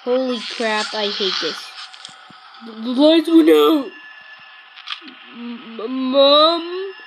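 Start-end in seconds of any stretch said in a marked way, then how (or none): 2.7-3.9 louder alarmed cry; startled cry. Real, not acted. Lights went out in the game, a louder startled cry. 5.3-6.9 scared call. Real, not acted. Scared in the dark.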